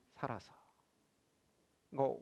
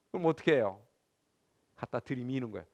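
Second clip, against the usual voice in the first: normal talking voice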